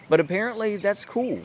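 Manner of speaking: high, mock-approving "that's cool"; sarcastic. Real, not acted. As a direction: say it with biting sarcasm. Sarcastic remark.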